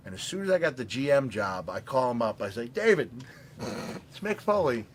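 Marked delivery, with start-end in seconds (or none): 2.7-4.9 higher and louder, calling out the name; eager phone greeting. Retold or imitated. Foley calling the tailor to order a suit, eager hello. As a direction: greet an old contact with a burst of energy.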